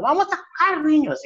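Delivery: high, sweet kindergarten teacher; sing-song teacher voice. Retold or imitated. Teacher calling the kids to play, in a high sing-song voice. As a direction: chirp it like a cheerful teacher. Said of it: "la maestra que está hablando".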